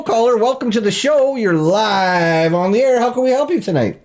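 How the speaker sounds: upbeat late-night radio host voice; bright, welcoming call-in host; talk show host. Acted.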